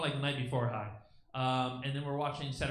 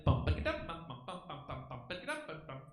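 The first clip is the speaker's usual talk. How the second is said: hummed bachata beat; 4 dB quieter than usual. Retold or imitated.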